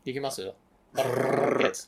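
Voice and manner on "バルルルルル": バルルルルル with a long tongue trill; rolled r. Shown as a demonstration. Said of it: "巻き舌できない人とかもいるじゃん".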